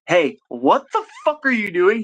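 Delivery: retold yell. Calling his fighter about paperwork, retold high yell. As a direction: play back yelling at your fighter. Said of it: "I had to yell at him".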